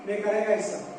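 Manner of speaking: ordinary talk, nothing marked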